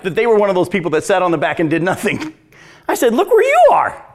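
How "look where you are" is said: high, loud rebuke; mock scolding call; exclaiming. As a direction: throw it back at them sharply. Pastor recalls rebuking pastors.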